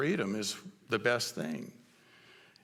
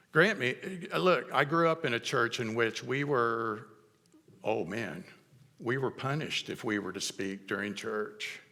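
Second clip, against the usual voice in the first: ordinary talk, nothing marked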